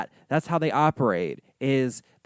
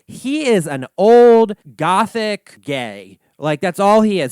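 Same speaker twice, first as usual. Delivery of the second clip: slow, loud, higher campy verdict; sassy declaration; 4 semitones higher, 7 dB louder, slower than usual. Real, not acted. Dismissing Crowley to viewers, slow sassy and loud. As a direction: deliver it like a diva's final word.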